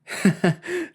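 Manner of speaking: short high laugh. A narrator's reading. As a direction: burst out laughing. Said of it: "Chloe laughed".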